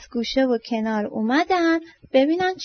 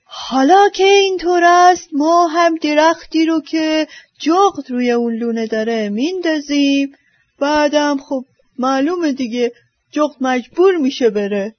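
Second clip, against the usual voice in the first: louder scheming fox voice; sly fox; 5 dB louder than usual. Acted. Fox proposing to knock down the owl's tree, sly and scheming. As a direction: lay out the plan with cunning. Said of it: "روباه گفت".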